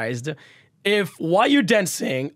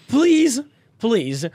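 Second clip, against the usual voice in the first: pleading; 7 semitones higher, 4 dB louder, slower than usual. Real, not acted. Begging viewers not to chug.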